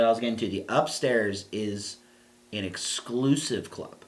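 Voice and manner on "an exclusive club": ordinary delivery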